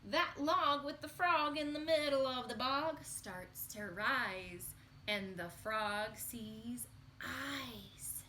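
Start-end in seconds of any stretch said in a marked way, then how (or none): 3.1-8.2 slow, drawn-out suspense; slow it right down before the reveal; suspenseful slow. Acted. Teacher building suspense for the children, very slow.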